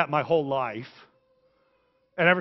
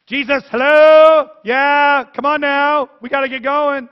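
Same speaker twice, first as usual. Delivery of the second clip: much higher, much louder impatient call; impatient Jairus; 10 semitones higher, 14 dB louder, slower than usual. Acted. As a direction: hurry Jesus along, exasperated.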